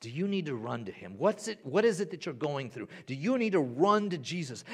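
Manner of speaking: usual speaking voice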